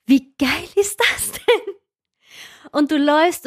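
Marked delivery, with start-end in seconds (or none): 0.1-1.7 thrilled. Retold or imitated.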